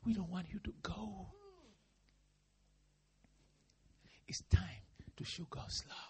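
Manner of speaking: whispered challenge; soft urgent whisper; hushed. Real, not acted. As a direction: drop to an intimate whisper. Quiet challenge to church.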